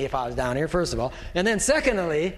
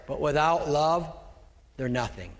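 ordinary delivery

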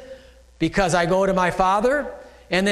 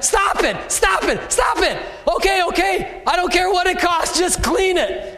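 panicked woman; 12 semitones higher than usual. Retold or imitated. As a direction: squeal it like she can't bear to see more. Woman begging him to stop.

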